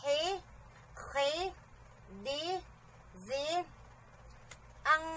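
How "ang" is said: ordinary talk, nothing marked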